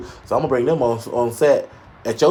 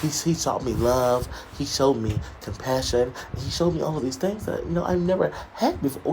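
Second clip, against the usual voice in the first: mock refined Sierra, hushed and precious; 3 semitones lower, 9 dB quieter than usual. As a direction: play the polished rich wife, gently. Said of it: "Sierra talk like this now".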